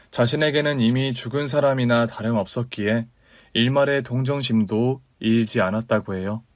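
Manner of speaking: usual speaking voice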